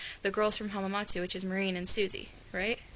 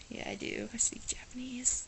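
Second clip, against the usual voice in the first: soft unvoiced reply; whispered comeback to surprised classmates; whispered retort; 3 semitones higher, 6 dB quieter than usual. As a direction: whisper it back smugly.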